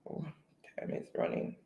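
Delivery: a whispered note to self; hushed aside to herself. Real, not acted. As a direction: breathe it almost silently. Host whispers reminder to self.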